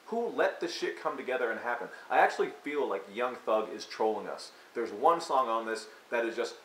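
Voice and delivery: ordinary talk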